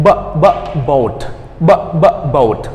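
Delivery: "b, boat" then "b, b, boat"; sound drill. Shown as a demonstration. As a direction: repeat "boat" after b pops. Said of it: "You say b".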